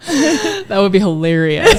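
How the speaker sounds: slow, laughing through the words; amused, laughing as she says it; giggling reply. Retold or imitated. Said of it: "And I'm like".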